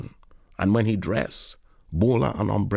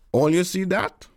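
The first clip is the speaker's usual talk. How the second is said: Moses voice; 9 semitones higher, 3 dB louder than usual. A narrator's reading. Moses calls to boys.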